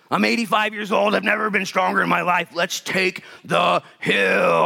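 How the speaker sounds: high-pitched, eager rallying declaration; acted old warrior raring to go; rousing battle cry. Acts out Caleb's eager battle cry.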